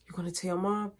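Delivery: unvoiced, hushed worry; whispered question. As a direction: whisper so mom won't hear. Son whispers to dad.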